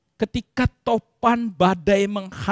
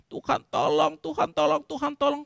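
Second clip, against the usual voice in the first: higher, softer plea to God; pleading prayer; 8 semitones higher, 5 dB quieter than usual. Acted. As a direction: beg God for help, over and over. (memohon tolong)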